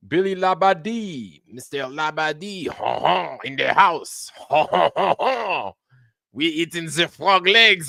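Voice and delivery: hammed-up French accent with honking fake laughs; mock French accent. Host welcoming a viewer to the house, hammy French accent. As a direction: greet like a cartoon Frenchman, drawn-out French accent.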